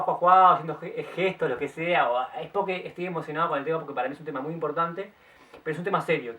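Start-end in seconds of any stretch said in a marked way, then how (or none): 0.0-0.5 a quick, hammed-up "juá juá" laugh; mock laugh. Shown as a demonstration. Telling viewers he may laugh, a mock laugh. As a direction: show them how you might burst out laughing. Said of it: "si me ven riendo".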